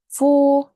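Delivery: teacher stressing Four to the class; stressed Four. Real, not acted. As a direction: hit the word Four hard. Stressing the count of emphases.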